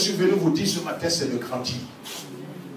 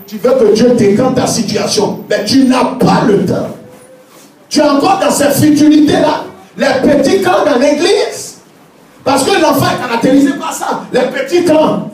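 preaching at full force; 7 semitones higher, 8 dB louder than usual. Acted. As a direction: thunder it at the congregation.

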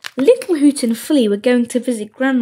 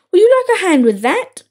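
higher, lilting polite offer; feigned helpfulness; 6 semitones higher, faster than usual. A narrator's reading. Willy sneaking up on Grandma, falsely helpful. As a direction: offer help sweetly, pitched up.

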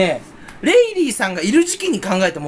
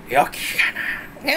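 川の主の物真似. Retold or imitated. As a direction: imitate an old river spirit, voiceless then droning. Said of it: "じゃあ、どうぞ". (川の主のうなり声の真似)

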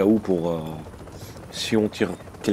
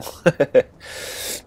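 breathy laugh; 6 semitones higher, 3 dB louder than usual. Real, not acted. Laughs at surrendering to players.

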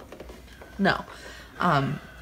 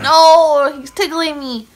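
loud, higher, laughing squeals; being tickled; 8 semitones higher, 13 dB louder, slower than usual. Real, not acted. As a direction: shriek while being tickled. He's tickling me.

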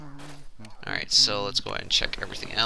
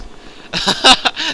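surprised laugh; 14 semitones higher, 6 dB louder than usual. Real, not acted. Boxes moving strangely.

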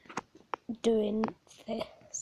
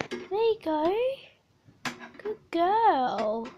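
high, louder pet praise; praising a pet; 6 semitones higher, 6 dB louder, slower than usual. Real, not acted. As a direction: reward her like a good dog. Praising hamster.

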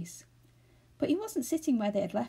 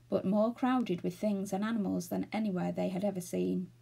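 plain reading voice

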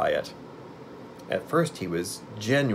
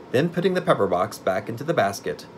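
plain reading voice

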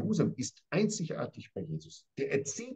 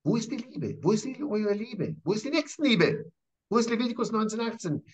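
pitched higher, pressing the questions ever harder; provocative questions; 7 semitones higher than usual. Acted. Jesus challenges the scribes, higher and insistent. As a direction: demand it of them, rising in challenge. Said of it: "er fragt sie auch provokant".

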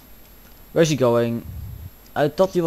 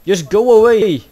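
exasperated yell; 6 semitones higher, 10 dB louder than usual. Real, not acted. Player killing an NPC, exasperated yell. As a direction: yell to drive them off. Pursuer still attacking.

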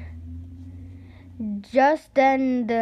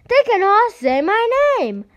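high, louder worried wail; dismayed boy; 11 semitones higher, 9 dB louder than usual. Acted. Boy realizing every fly says his name, high worried. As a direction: fret it out loud.

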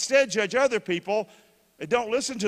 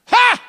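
scoffing laugh; 14 dB louder than usual. Real, not acted. Scoffs at a dropped ball.

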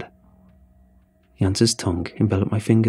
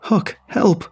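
high, panicked cry for help; calling for help; 10 semitones higher than usual. Acted. Fleeing the deer to his cabin, desperate cry. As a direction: scream for him to save you. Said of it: "I started yelling".

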